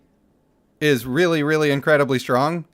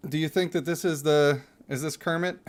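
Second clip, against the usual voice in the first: ordinary talk, nothing marked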